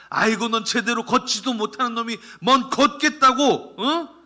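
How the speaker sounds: loud, high, exasperated scolding; scolding father. Retold or imitated. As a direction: scold the fallen child harshly. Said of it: "이런 아빠 보셨어요?".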